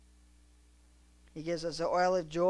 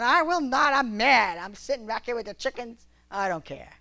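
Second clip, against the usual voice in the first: louder and higher mock fury; mock angry sulk; 5 semitones higher, 10 dB louder than usual. Tired preacher refusing his wife, hammed-up fury. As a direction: ham up a grumpy man sulking. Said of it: "He's going".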